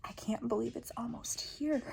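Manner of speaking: soft, breathy whisper of disbelief; tearful disbelief. Real, not acted. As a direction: barely get it out through tears. Tearful goal confession.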